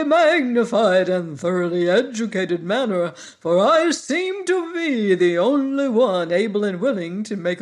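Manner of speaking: lightly voiced pompous bug; Woggle Bug voice. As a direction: puff up like a know-it-all bug.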